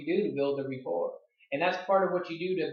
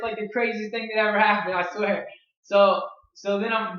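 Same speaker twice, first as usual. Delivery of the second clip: ordinary talk, nothing marked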